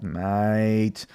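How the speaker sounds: drawn-out Australian "mate"; Australian accent. Shown as a demonstration. Host practising for viewers, Australian accent. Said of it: "working on my Australian accent".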